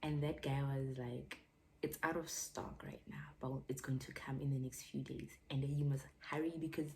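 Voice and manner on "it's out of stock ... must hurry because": confidential. Retold or imitated. Acting out the seller's quiet tip.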